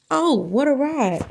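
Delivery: plain reading voice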